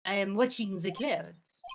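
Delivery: slow put-on French accent; measured, drawn-out words in a French accent. Vasquez brushing off visitors, French accent. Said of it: "My French accent is amazing".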